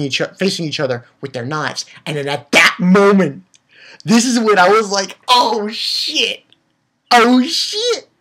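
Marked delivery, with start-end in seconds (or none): 5.3-8.1 stunned excitement. Retold or imitated. Reenacts stunned reaction to scene.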